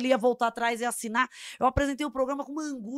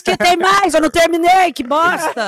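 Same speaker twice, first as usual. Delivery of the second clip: loud rant; 6 semitones higher, 10 dB louder than usual. Real, not acted. Loud joking rant over cohost.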